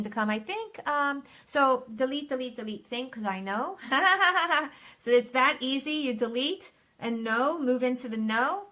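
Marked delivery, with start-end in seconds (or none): 3.8-4.7 a real burst of laughter; bright laugh. Real, not acted. Coach laughing at a viewer's comment, loud bright laugh.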